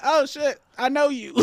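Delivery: high, startled squeal; mock shock at spotting her; surprised shriek. Landlord recognizing tenant comic.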